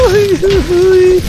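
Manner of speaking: high loud startled uy; startled yelp. Real, not acted. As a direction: yelp in surprise. Startled by snake.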